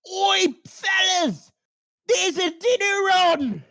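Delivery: high, loud squeaky Cockney shout; rat shout. Acted. Rat calling the other rats to dinner, high loud shout. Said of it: "you hear him shout".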